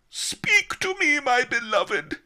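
high, loud grief-stricken scream; hammed-up anguished cry. A narrator's reading. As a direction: scream desperately to the dead. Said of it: "I screamed".

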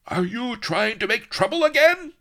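high, loud, blaring bellow. Acted. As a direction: blast it at a hapless servant. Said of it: "blared Don Lardo".

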